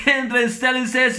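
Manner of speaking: usual speaking voice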